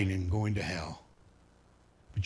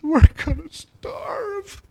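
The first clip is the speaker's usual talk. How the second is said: much higher, louder, slow mock wail; imitating crying daughter; 9 dB louder, much slower than usual. Daughter in the car fearing starvation, mock sobbing wail. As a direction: wail it like a panicked teen in tears. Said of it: "And she starts crying".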